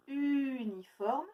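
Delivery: uniforme slowly, lingering on the u; drawn-out word. Shown as a demonstration. Teacher to pupils, slowly stretching the word. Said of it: "d'autres mots où on entend U".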